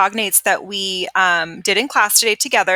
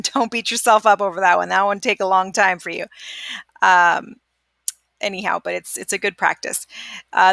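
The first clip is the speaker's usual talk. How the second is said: usual speaking voice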